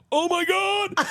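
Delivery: high squealing laugh-through words; laughing dad imitation. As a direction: crack up mid-phrase.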